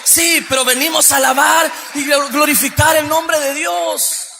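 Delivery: loud, sustained proclamation; full-force preaching. Real, not acted. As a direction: declare it defiantly from the pulpit.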